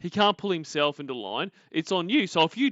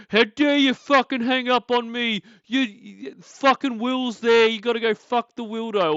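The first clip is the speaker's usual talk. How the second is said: loud, high imitation of a furious rant; mock screaming rant; 8 semitones higher, 6 dB louder than usual. Host imitating him raging at being hung up on, mock screaming rant. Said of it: "when he's fucking ranting and screaming".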